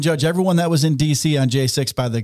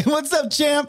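cheery greeting; 11 semitones higher, slower than usual. Pretend. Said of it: "when I call him up, say".

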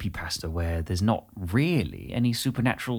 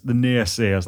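put-on Redcar accent; slower than usual. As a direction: savour the word in a Teesside accent. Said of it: "quite good to say in that accent".

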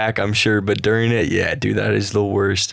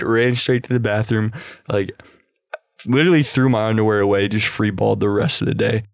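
normal talking voice

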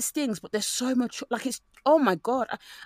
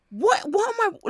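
higher, puzzled what; mock bafflement; 11 semitones higher than usual. Reacting to the show in high mock confusion. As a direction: sound baffled watching. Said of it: "I'm like".

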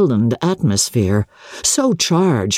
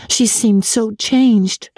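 put-on lightly higher young wife, slow, "changed" falling; 5 semitones higher, slower than usual. May remarks on her cousin.

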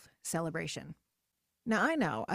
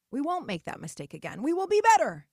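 loud, high, falling on "better"; mock determined vow; 5 semitones higher, 6 dB louder than usual. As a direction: vow it like a pep rally. Mocking resolve.

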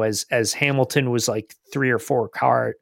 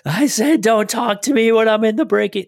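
high, loud mock scream over team radio; exasperated shout, pitched way up; retold radio scream; 11 semitones higher, 5 dB louder than usual. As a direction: imitate a driver yelling at his engineer. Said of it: "continually screaming over the radio".

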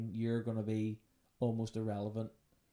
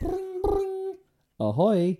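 high ringing bring, then loud ahoy; phone ring and ahoy; 11 dB louder, much slower than usual. Pretend. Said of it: "Just imagine that".

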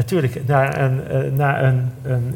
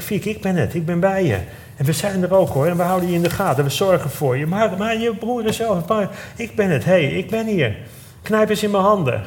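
urgent reassurance; 6 semitones higher than usual. Retold or imitated. Calling to friend in hospital bed.

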